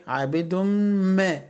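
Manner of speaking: عابد ما merged into a long hummed m; idgham with ghunna. Shown as a demonstration. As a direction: slowly hum the m joining عابد to ما. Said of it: "يبقى هنا إدغام بغنة". (إدغام عابد ما بغنّة)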